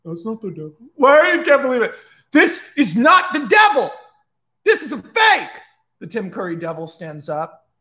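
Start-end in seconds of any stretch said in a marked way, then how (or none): 0.0-0.8 very soft acted aside; hushed character voice; muffled quiet remark. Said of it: "Jason is like". 0.9-5.6 high, loud theatrical accusation; indignant mock shouting; acted outraged protest. Acted outcry exposing a false devil.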